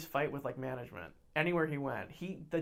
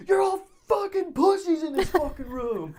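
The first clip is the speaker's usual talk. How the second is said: high, very loud insult; imitated furious shout; 13 semitones higher, 9 dB louder than usual. Furious bully insulting the whole room, imitated shout. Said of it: "furious at my friend Anthony".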